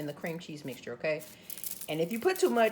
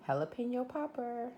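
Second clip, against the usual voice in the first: normal talking voice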